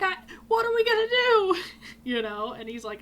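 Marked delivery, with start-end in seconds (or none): 0.0-1.6 very high, loud tearful wail; freaking out. Retold or imitated. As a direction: wail in hammed-up helpless panic. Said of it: "I was freaking out".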